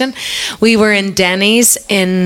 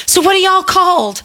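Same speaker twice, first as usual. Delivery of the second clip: imitated Southern accent; 10 semitones higher than usual. Irish singer imitating the Roswell waitress in a Southern accent. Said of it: "I'm gonna stay in Irish accent here".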